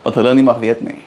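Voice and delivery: conspiratorial Afrikaans in a fast high voice; secret language chatter. Acted. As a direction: rattle it off like a secret gossip. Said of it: "Speaking in that reverse language again".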